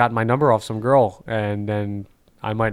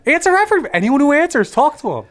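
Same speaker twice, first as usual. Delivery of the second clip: excited; 4 dB louder than usual. Real, not acted. Excited suggestion, very high.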